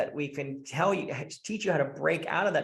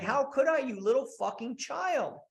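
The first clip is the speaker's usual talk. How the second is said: higher-pitched mock scolding; exasperated wife; 6 semitones higher than usual. Wife telling off her childish husband, mock exasperation. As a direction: scold him like a fed-up wife.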